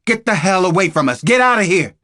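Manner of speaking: shouting at armed agents to back off; yelled. A narrator's reading. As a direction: lose it and yell them off. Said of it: "I lost it and yelled at them".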